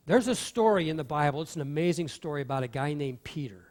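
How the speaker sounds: normal talking voice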